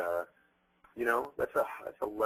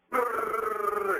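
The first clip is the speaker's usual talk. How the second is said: high rolling rattle of a firecracker burst; mock firecracker noise, pitched way up; imitated firecrackers; 13 semitones higher, 6 dB louder than usual. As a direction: spit out a fast crackling burst. Firecracker wheel going off.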